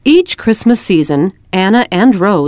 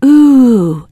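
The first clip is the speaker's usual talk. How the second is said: ooh of wonder; 5 semitones higher, 4 dB louder than usual. A narrator's reading. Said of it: "practice saying".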